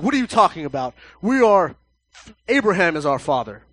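loud offended protest; the crowd shouting back at Jesus; indignant crowd. Retold or imitated. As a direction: push back loudly, offended. Crowd indignant in retold argument.

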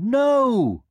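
put-on high, loud child's protest; shouted refusal. A narrator's reading. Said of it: "the prince shouted".

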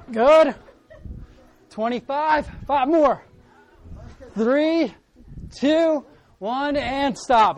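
loud, high workout countdown calls; aerobics instructor. Real, not acted. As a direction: pump up the class, counting down.

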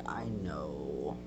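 breathy smug whisper. Retold or imitated. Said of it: "and I was like".

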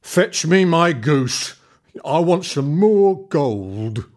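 slow, loud giant's command; demanding giant. A narrator's reading. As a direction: bark the order, slow and heavy. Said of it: "then demanded".

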